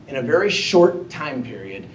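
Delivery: ordinary delivery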